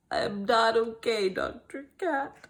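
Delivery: plain reading voice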